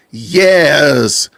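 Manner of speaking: "Yes" stretched out long, as if two syllables; extra syllables. Retold or imitated. Dad imitating his kids, Yes drawn out with an extra syllable. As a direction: drag out Yes into "ye-es". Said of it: "you're adding extra syllables to a word".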